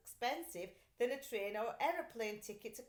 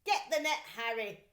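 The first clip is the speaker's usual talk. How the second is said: raised, louder policeman voice; 8 dB louder than usual. A narrator's reading. Policeman calling to his partner, louder. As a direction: bark it as the eager policeman. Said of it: "said the second policeman".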